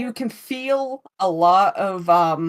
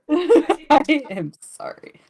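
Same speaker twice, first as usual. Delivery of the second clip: laughing apology; 8 semitones higher, 6 dB louder, slower than usual. Real, not acted. Laughing echo of a player's apology.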